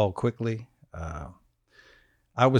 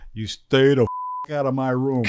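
louder, slow retold warning; angry coach imitation; 3 semitones higher, 5 dB louder than usual. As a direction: play the fed-up coach laying down the law. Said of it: "He goes".

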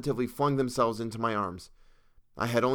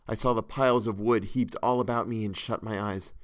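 ordinary delivery, nothing marked

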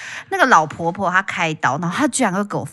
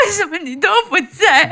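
much higher, laughing whiny complaint; mock whining; 10 semitones higher than usual. A patient on video call to her boyfriend, mock whining. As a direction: whine it half-jokingly. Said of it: "我就说".

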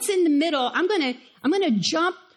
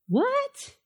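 mock surprise; 11 semitones higher than usual. Rhetorical question to congregation.

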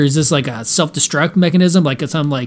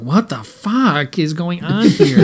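retold bewilderment; 4 semitones higher, slower than usual. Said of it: "I was like".

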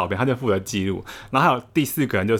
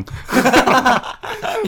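high loud laugh climbing up; rising laughter; 14 semitones higher, 5 dB louder than usual. Real, not acted. Recalling telling roommate mesh shirt is cool, rising laugh. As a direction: let the laugh climb as the joke lands.

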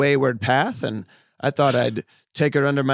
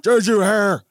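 voicing the dog; 6 semitones higher than usual. Voicing the dog running toward the couple, excited and high. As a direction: speak as the chatty barking dog. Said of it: "he was barking as he's running towards you".

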